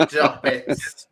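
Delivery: laughing through "stop it"; giggling protest at a teasing joke; real amused laugh in the words. Real, not acted. Teased, laughing at jokes.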